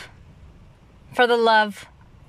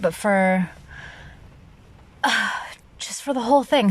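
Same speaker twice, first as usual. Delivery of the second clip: ordinary talk, nothing marked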